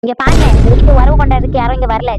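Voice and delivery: loud, forceful rebuff; angry shout. Acted. Visitor refusing the host's friendly welcome, angry shout. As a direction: cut the welcome off at full volume.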